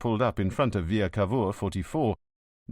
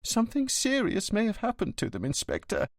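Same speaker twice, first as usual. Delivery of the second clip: voice cracking; 11 semitones higher than usual. Acted. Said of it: "His voice began to crack".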